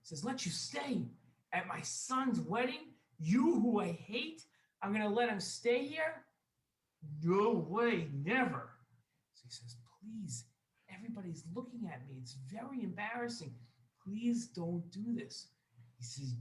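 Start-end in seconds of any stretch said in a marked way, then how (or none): 0.2-8.7 high, loud, drawn-out outrage; incredulous anger. Acted. Wealthy man refuses to let his enemy stay, loudly outraged. 10.0-15.5 hushed, higher-pitched begging; embarrassed pleading. Acted. Embarrassed guest begs the host not to shame him, hushed pleading.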